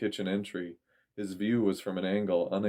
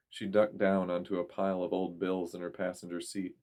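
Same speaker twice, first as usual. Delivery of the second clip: ordinary delivery, nothing marked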